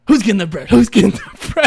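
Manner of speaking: loud, teasing tickler breaking into laughter; creepy tickler. Retold or imitated. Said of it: "you don't know what he said".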